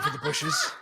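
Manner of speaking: squealing with laughter; laughing. Real, not acted. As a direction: laugh the words out high. Joking line, laughing.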